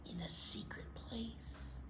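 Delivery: whispered like a secret. Acted. Reading to kids, whispering the secret hiding spot.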